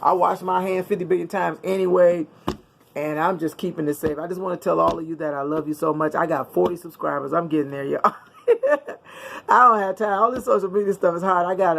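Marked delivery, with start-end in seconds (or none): none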